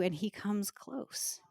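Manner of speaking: whispered, slow and hushed. Real, not acted. Preacher telling grieving listeners God is near, whispered. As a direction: breathe it like a secret close by. Tender moment of God's nearness.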